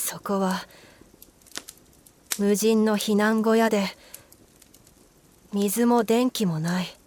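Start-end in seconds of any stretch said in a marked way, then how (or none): none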